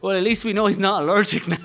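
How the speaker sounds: laughing dad. Retold or imitated. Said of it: "He's like".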